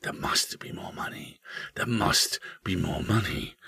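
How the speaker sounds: hushed, whispered haunting refrain; soft, secret whisper; eerie whisper. A narrator's reading. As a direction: whisper it like a voice hidden in the house. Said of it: "a voice would start whispering".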